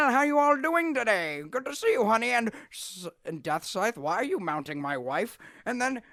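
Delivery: high, loud, bouncy cartoon father; cheerful Lord Death. Lord Death explains to his son why mother is gone, high and jaunty.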